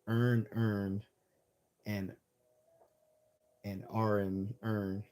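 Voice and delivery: imitating Baltimore, vowels merged into "earn"; slow Baltimore-accent demonstration, word by word. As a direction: mimic how Baltimore locals flatten the phrase. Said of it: "that's how they would sound".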